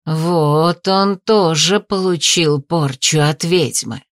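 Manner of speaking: plain reading voice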